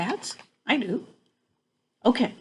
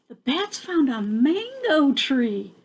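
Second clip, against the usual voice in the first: ordinary delivery, nothing marked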